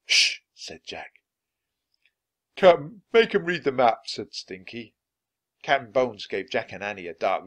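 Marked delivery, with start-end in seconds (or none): 0.1-0.4 hushing shh. Acted. Hushing sister. 2.5-4.1 throaty voice. Acted. Stinky pirate speaks.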